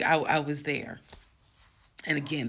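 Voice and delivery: usual speaking voice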